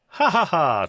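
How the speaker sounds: deadpan forced laugh to fake amusement; a loud, obviously fake courtesy laugh. Shown as a demonstration. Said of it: "If I go".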